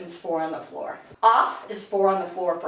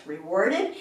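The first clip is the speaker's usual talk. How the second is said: normal talking voice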